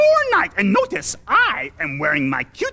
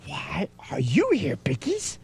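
whispering; 10 semitones lower, 6 dB quieter than usual. Acted. Grover hushes the pigs.

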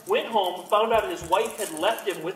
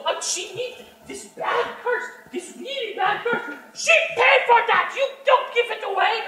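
higher, laughing excuse; goblin husband; 5 dB louder than usual. Acted. Husband insists to wife the buyer paid, laughing. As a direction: fumble through the cover story. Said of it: "my wife comes out".

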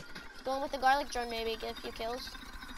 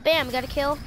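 ordinary delivery

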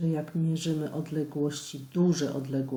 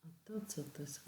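whispering; 16 dB quieter, faster than usual. Real, not acted. Teacher muttering to herself.

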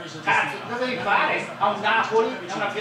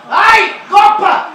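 excited yell; 10 semitones higher, 11 dB louder, much slower than usual. Real, not acted. Fan yells at the TV during match.

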